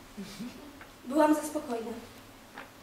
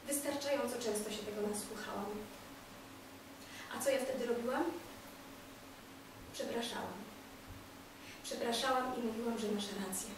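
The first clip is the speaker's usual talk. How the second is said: subdued; 6 semitones lower, 17 dB quieter than usual. Acted. Bitter recollection after outburst.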